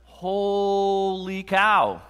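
very drawn-out, high, loud exclamation; awestruck. Real, not acted. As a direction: stretch it out in sheer amazement. Marvelling at the collapsing wall.

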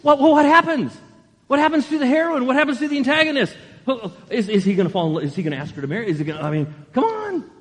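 a hooked viewer begging to know what happens next; frantic viewer. Retold or imitated. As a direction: blurt questions in a rush of suspense. Viewer reacting to cliffhanger.

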